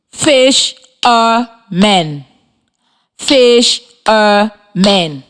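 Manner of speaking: slow, syllable by syllable: fish-er-men; clapped syllables. Shown as a demonstration. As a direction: split "Fishermen" into three clapped beats, slowly. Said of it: "while I clap the syllables in the word".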